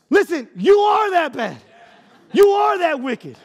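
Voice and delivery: high, driving sermon delivery; emphatic preaching. Real, not acted. Preacher confronting congregation about sin, emphatic preaching. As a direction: hammer each declaration home to the room.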